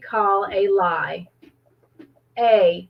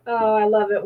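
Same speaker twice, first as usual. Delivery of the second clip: usual speaking voice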